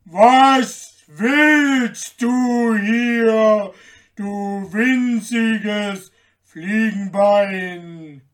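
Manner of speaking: huge booming giant shout, very slow; thundering giant. A narrator's reading. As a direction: thunder it out, stretching every word. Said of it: "rief er mit einer Stimme, als wenn's von allen Seiten donnerte".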